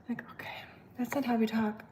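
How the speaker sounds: hushed whisper to the camera; whispered aside. Real, not acted. Whispering to viewers about how odd the German app sounds. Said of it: "whispered aside".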